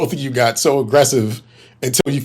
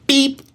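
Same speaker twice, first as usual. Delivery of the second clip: imitated beep. Imitating the horn.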